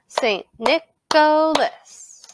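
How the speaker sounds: "Saint Nicholas" slowly in separate beats; syllable by syllable. Shown as a demonstration. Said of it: "Clap that with me now".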